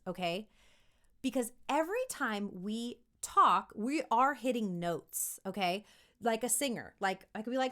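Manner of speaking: ordinary delivery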